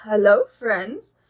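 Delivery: ordinary delivery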